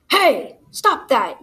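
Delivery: loud, high warning yell. A narrator's reading. Little Tim Tim scares off mean crows, yelling. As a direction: shout to break up the bullying. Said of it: "yelled Little Tim Tim".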